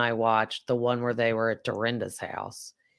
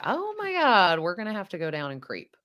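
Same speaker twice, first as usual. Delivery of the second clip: mock excited scheme; 7 semitones higher than usual. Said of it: "I was like".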